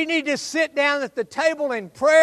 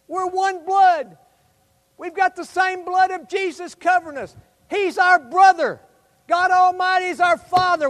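fervent preaching; 3 semitones higher, 3 dB louder than usual. Acted. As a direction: preach it with conviction. Preaching at full force.

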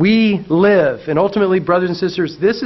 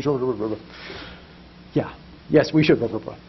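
normal talking voice

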